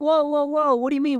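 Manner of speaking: high, flustered string of whoas; taken aback. Retold or imitated. Technician to customer who dismissed him, taken aback. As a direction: imitate a startled man trying to halt someone.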